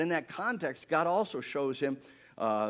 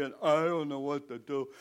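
baffled cry out to God, voiced up; distressed prayer. Moses praying at the blocked sea, crying out helplessly. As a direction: plead helplessly, as acted prayer. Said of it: "he starts to pray".

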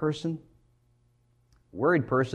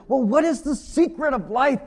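lightly voiced Billy Crystal; 12 semitones higher than usual. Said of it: "And Billy Crystal asked him".

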